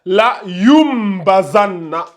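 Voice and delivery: "La yum bazanna" slow, with a long hummed m; qalb pronunciation. Shown as a demonstration. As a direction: hold "yum" before moving into "bazanna". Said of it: "Ça se lit comme ça". (« La yum bazanna » avec un m longuement tenu)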